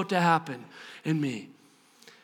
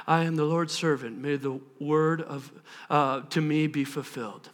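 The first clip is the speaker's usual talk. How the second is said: low, subdued quote; uninspiring recital; 9 semitones lower, 6 dB quieter than usual. Acted. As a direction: recite it without any excitement. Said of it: "aren't all that inspiring".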